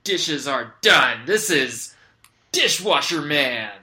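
loud, slow heroic intro; heroic proclamation. Acted. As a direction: announce yourself like a superhero. Hero introduces himself.

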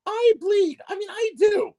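very high, put-on bride voice; squeaky bride voice. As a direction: squeak out your vows like a nervous bride. Playing the bride at the altar.

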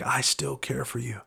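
tender divine reassurance; gracious. Retold or imitated. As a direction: speak it gently with grace. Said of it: "the Lord just coming graciously saying".

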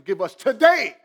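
loud, high shout that peaks on "today"; screaming emphasis. Acted. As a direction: shout it as an urgent demand, hammering "today". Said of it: "It's like he's screaming".

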